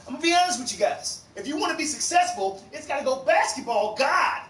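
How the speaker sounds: high, earnest coach preaching his priorities; shady coach. Retold or imitated. Crooked coach lecturing players on priorities, high and earnest. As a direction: lecture the team like it's gospel. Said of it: "He used to be like".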